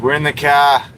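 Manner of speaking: imitated Boston catchphrase, "car" without its R. Streamer doing his friend's catchphrase for chat, Boston accent. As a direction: play up the Boston "kah".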